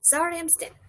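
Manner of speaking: light Canadian voice, apologetic; Canadian accent. Retold or imitated. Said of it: "I'm trying to do the Canadian accent".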